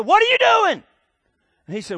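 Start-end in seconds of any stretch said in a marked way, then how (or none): 0.0-0.8 high shrieking yell at the customer; furious high-pitched bartender screech; bartender's scream. Retold or imitated. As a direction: scream it in shock as the beer hits your face. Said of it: "The, the bartender screamed".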